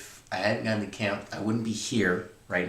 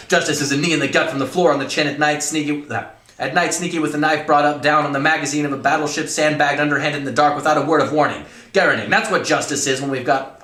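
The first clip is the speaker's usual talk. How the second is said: loud, high-pitched ranting colonel; dramatic story reading; 6 semitones higher, 10 dB louder than usual. Acted. Colonel telling cadet what justice is, ranting. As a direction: build a relentless tirade, hammering each phrase.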